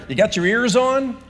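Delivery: high, loud mock radio call; trucker on CB. Trucker calling his fellow trucker, mock radio hail. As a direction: hail a buddy over the CB. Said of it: "the trucker on the highway who says".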